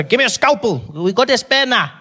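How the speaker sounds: lightly acted urgent call across the operating table; harried doctor. Doctor calling for a scalpel, hammed-up urgency.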